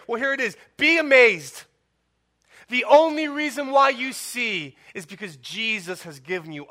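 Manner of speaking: impassioned pulpit voice; preaching at full force. Acted. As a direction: urge the congregation to be amazed. Sustained loud preaching.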